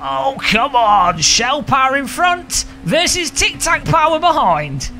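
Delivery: high, slow dramatic race call; excited commentary. Acted. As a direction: announce it like a big showdown.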